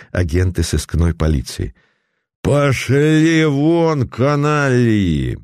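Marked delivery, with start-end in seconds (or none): 2.4-5.4 put-on drunken dismissal. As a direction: wave them off like a drunk lord. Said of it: "пьяным жестом отмахнулся".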